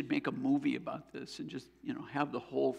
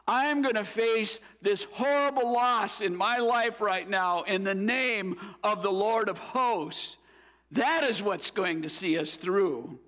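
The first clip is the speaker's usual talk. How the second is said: raised, ringing preacher's declaration, building; fervent sermon climax, louder and higher; preaching at full force; 5 semitones higher, 3 dB louder than usual. Acted. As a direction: proclaim it to the whole congregation. Preaching climax, rising intensity.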